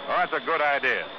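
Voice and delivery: ordinary delivery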